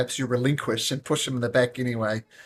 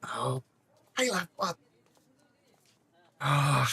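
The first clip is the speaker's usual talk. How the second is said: usual speaking voice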